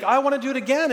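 put-on eager child voice. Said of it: "she was like".

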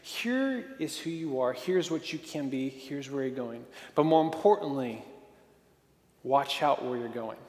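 soft and low, like a mentor speaking life into you; gentle mentor voice. Acted. Mentor gently telling a young man who he is, softly. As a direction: ease down, quiet and tender.

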